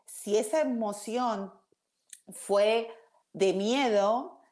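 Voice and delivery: ordinary delivery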